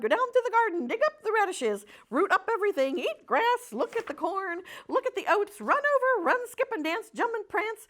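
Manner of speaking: high, loud tumbling list of joys; excited goose. Acted. Goose telling the pig what to do, high loud glee. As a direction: rattle off every delight at full voice.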